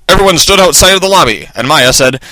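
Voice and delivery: ordinary delivery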